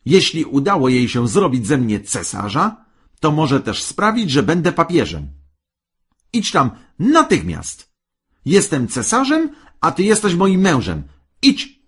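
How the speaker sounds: loud, high, domineering tirade; furious, high-pitched commands; shouting wife. A narrator's reading. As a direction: order the husband off at a yell. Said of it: "krzyknęła kobieta".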